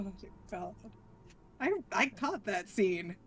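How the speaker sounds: ordinary delivery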